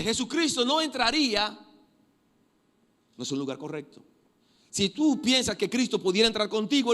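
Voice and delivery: high, loud pulpit preaching; impassioned sermon voice; fiery preaching. Acted. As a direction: drive the warning home to the church. Preaching at full force.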